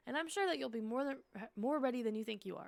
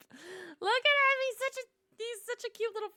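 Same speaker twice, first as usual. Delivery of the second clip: melting cute-overload coo; 11 semitones higher, 12 dB louder than usual. Real, not acted. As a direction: gush over something adorable. Cooing at a cute creature.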